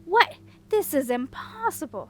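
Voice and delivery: high loud outraged disbelief; a wizard melting in outrage; indignant shock. A narrator's reading. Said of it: "said indignantly as he began to melt".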